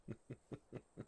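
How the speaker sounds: quiet, unvoiced embarrassed laugh; a little flustered laugh under the breath; soft nervous laugh. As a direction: dragon laughs softly at himself. Flustered dragon realizing his feelings.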